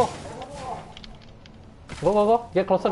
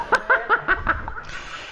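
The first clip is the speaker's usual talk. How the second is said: high laugh. Real, not acted. After winning the match.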